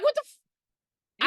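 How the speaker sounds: high, loud, drawn out "what the"; mock outrage. Viewer stunned at the chiropractor doctor, high mock outrage. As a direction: play stunned disbelief. Own shocked reaction.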